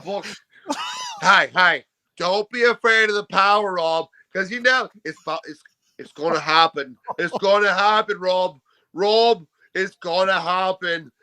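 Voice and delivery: hammed-up, wobbly Australian accent; Aussie impression. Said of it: "All right, do me".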